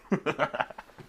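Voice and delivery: a quick high-pitched laugh; high giggle. Real, not acted. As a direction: giggle at your own joke. Laughs at own joke.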